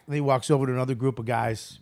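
usual speaking voice

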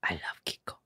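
soft unvoiced whisper. Real, not acted. Whispering an aside to the co-host. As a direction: breathe it out in a whisper.

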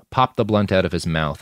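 ordinary delivery

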